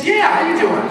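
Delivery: high, punchy, upbeat reply; exuberant greeting. Retold or imitated. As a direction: greet the cashier with big high energy. Acting his upbeat reply to cashier.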